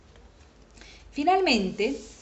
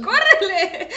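high, loud, laughing urging; playful mock-urgent call; laughing shout; 10 semitones higher, 9 dB louder than usual. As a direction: yell it through laughter. Teases child to run, laughing.

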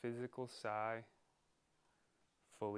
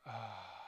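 audible "ah" breathed out; exhaling sigh. Shown as a demonstration. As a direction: sigh out on an open ah.

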